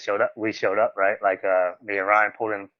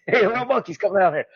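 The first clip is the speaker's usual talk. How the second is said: high-pitched put-on calling voice; imitated playful call; 10 semitones higher than usual. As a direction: imitate someone hailing kids from afar. Imitating DDP calling the kids.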